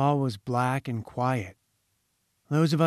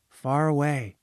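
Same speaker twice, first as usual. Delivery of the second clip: plain reading voice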